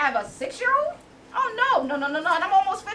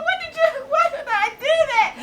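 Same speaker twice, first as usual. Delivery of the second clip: crying; 11 semitones higher than usual. Retold or imitated. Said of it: "Start crying".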